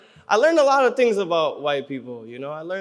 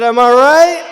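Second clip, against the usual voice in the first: shouted Am I right, higher and louder; hype-man shout to the crowd; louder repeat; 8 semitones higher, 12 dB louder, slower than usual. Real, not acted. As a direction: call it out again to the room. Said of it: "I said".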